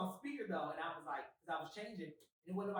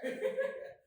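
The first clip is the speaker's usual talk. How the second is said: loud high laugh; 9 dB louder than usual. Real, not acted. Laughing at the niece joke, a loud high laugh.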